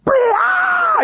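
very high, drawn-out mock scream; explosive shriek. Mom as lightning strikes by canoe, explosive shriek. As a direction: imitate mom exploding in panic. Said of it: "one of those just explosive things".

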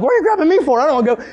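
high, loud mock whine; panicked protest. Child to mom dragging him ashore, panicked protest. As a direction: imitate a frantic kid objecting.

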